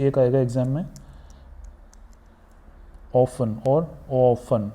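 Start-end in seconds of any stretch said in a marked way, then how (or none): none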